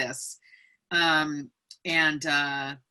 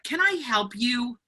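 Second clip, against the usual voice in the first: rude gatekeeping; 6 semitones higher than usual. Retold or imitated. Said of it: "She was so rude".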